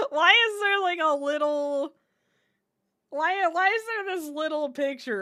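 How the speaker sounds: high, laughing question. Real, not acted. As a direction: laugh through the words. Laughing at the odd picture.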